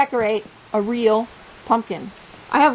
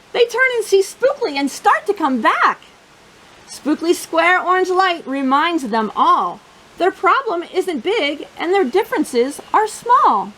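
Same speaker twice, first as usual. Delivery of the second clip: lively picture-book read-aloud voice; bouncy storybook narration for a young audience; reading to children; 3 semitones higher than usual. Acted. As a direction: read it like storytime for little kids.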